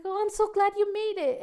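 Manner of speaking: high, loud, gushing greeting; happy, excited welcome. Retold or imitated. Women's pastor greeting a churchgoer, so happy. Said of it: "so happy".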